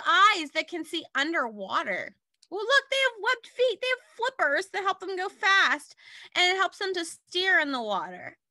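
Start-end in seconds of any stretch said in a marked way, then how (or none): 2.5-6.0 much higher, eager look-at-this voice; excited pointing out. Real, not acted. Teacher points at a picture.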